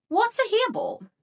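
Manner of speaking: ordinary delivery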